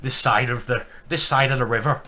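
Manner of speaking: loud stage Irish with a laugh behind it; mock Irish boast; hammed-up Irish accent. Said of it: "one of the nicest Irish accents".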